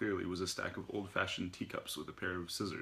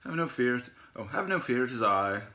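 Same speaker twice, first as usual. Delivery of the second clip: hammed-up British hero, high and loud; stagey British accent; 4 semitones higher, 7 dB louder than usual. A narrator's reading. Zen arriving through the dark, stagey British accent. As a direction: announce yourself grandly in theatrical British. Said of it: "with a stagey British accent".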